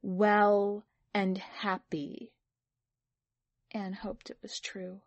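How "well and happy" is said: slow, drawn-out "well and happy"; emphatic repeat. A narrator's reading.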